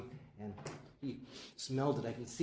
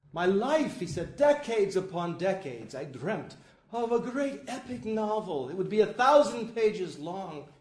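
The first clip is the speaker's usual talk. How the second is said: higher, rising to a loud shout; building excitement; 7 semitones higher, 11 dB louder than usual. Retold or imitated. Borges dreaming of his epic novel, building to a loud climax.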